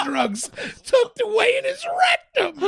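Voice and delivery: high, cracking up while reading; real laughter. Real, not acted. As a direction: barely get the words out laughing. Cracks up reading absurd article.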